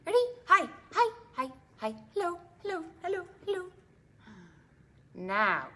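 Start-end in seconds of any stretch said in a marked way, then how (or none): 0.5-3.6 rapid high hellos tumbling out; fast hellos. Shown as a demonstration. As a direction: rattle off hellos as fast as you can. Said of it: "Let's say hello as fast as we can".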